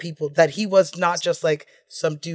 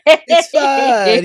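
put-on laughing Italian accent; 8 semitones higher, 9 dB louder than usual. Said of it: "Italian accent".

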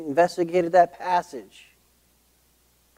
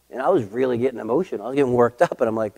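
usual speaking voice

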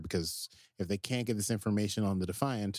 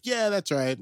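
higher, louder cocky confirmation; hammed-up bluff; 10 semitones higher, 5 dB louder than usual. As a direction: overplay the bravado. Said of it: "over the top bluffs".

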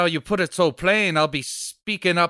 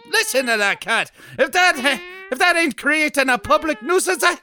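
higher, louder indignant outburst; indignant shout; 11 semitones higher, 6 dB louder than usual. Acted. Policeman shouts back at the neighbor over the cat, indignant. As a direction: stand your ground, outraged.

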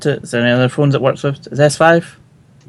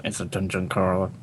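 low, quiet growly mimicry; mock grumble; 5 semitones lower, 11 dB quieter than usual. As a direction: imitate a friend grumbling the words under his breath. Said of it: "You sounded just like, "Grr".

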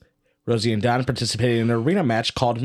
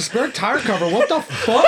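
baffled; 9 semitones higher than usual. Retold or imitated. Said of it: "I'm like".

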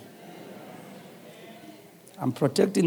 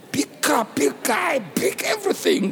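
starts raised and ends in a shout, higher and higher; building amazement; 9 semitones higher than usual. Retold or imitated. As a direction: pile it on, each "big" higher than the last. Building awe at the big man.

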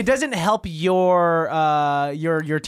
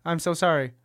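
ordinary talk, nothing marked

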